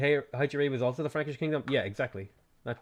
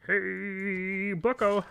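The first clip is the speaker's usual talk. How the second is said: higher smug Canadian call; Jordan Peterson impression; 7 semitones higher, much slower than usual. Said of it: "Jordan Peterson Canadian accent".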